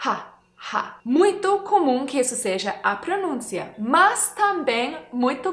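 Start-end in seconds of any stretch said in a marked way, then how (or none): 0.1-1.0 Ha, ha with the h as a soft puff of air; light breathy h. Shown as a demonstration. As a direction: let the air out gently on Ha. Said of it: "deixa esse ar sair levemente".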